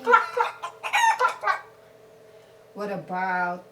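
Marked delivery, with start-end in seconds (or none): 0.0-1.6 higher playful mock cluck cluck; chicken clucking. Shown as a demonstration. Showing the child a chicken's sound, mock clucking. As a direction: cluck like a hen. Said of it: "What's the sound of chicken?".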